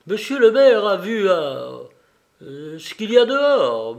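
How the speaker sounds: agitated shout, tripping over the words; stammering excited cry. A narrator's reading. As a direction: cry it out, so excited you stumble. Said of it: "me cria Ryu bégayant tant il était excité".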